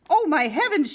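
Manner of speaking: shocked mother crying out; cried out. A narrator's reading. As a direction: gasp it out in alarm. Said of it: "she cried".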